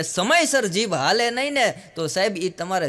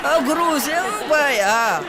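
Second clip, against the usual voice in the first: knowing aside; 6 semitones higher, slower than usual. Real, not acted. Comic aside to audience.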